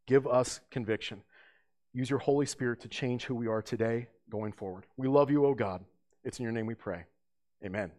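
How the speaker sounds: soft, hushed opening prayer. Real, not acted. Pastor praying over the congregation, hushed and earnest. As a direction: pray quietly over the listeners.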